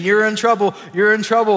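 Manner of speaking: loud, high, repeating accusation; insistent voice that can't be drowned out; cranked-up speaker voice. Acted. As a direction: blare it like speakers at full volume. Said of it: "cranked up the volume and those speakers".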